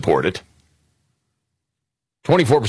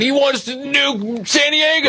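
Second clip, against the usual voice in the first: mock outrage; slower than usual. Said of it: "De Niro or Ruffalo or somebody saying".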